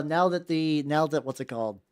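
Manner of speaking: ordinary delivery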